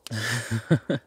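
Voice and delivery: brief amused chuckle; amused little burst of laughter; short laugh. Acted. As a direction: let out a quick laugh. Amused reply to companion.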